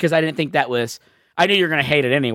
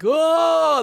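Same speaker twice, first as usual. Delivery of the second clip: high surprised oh; 12 semitones higher than usual. Retold or imitated. Ghost surprising climbers.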